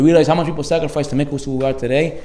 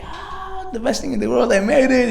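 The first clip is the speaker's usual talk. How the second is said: relieved triumph; 7 semitones higher than usual. Retold or imitated. Climber triumphant on the roof.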